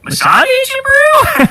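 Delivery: very high squeaky mock disbelief; mock incredulous. Host mimics someone denying being a Mossad agent, mock incredulous. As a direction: pitch it way up, playing the shocked innocent.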